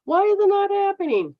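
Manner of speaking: mock a lottery dreamer whining; whiny wisher. Mocking someone wishing to win the lottery, high whine. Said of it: "when somebody says to me".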